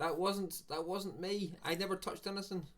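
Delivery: high-pitched, insistent denial; protesting innocence. Real, not acted. Streamer denies breaking the stream, high protest. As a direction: plead not guilty in a high voice. Repeats denial once back live.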